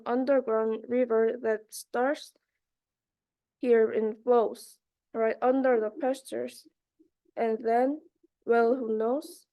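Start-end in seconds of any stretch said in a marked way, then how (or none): none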